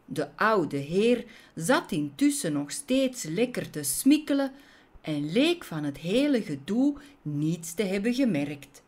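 ordinary delivery, nothing marked

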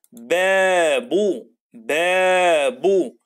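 بابو very slow, the first a drawn out; stretched بابو. Shown as a demonstration. Teacher modelling a long vowel in بابو, very slow. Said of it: "با زي كلمة إيه".

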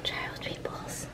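soft, breathy gloating whisper; creepy whisper. Acted. Animatronic gloating over child meatballs, creepy soft whisper. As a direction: whisper it like a treat you crave.

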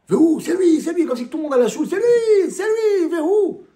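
teacher mocking the crowd that points at the sinner; mock accusing crowd. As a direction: point the finger at him like a jeering crowd.

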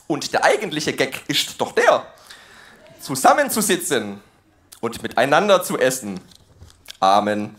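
God trying hard at proper German; careful High German. Acted. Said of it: "bemüht sich Gott jetzt Hochdeutsch zu sprechen".

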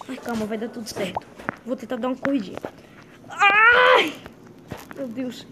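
ordinary delivery

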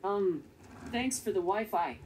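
flat, even robot delivery; robot monotone voice. Acted. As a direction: speak like a calm little robot. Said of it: "I'm just a robot".

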